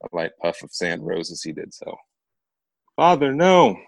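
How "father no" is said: high, loud cry of despair; anguished cry. A narrator's reading. Girl sees her father fall dead, anguished cry. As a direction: cry out as he falls.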